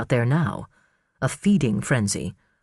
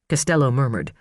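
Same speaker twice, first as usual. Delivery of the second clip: ordinary talk, nothing marked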